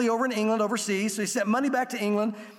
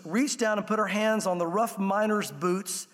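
ordinary delivery

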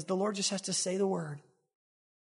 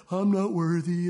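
put-on falsely humble mope; mock moping; 3 semitones lower, slower than usual. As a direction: mope it out in exaggerated self-pity. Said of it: "you know, how we mope".

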